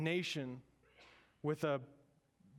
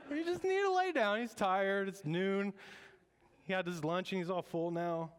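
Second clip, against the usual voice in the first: much higher, louder sing-song taunt; mocking Elijah; 5 semitones higher, 3 dB louder than usual. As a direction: tease the prophets like a cheeky kid. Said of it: "Elijah is saying".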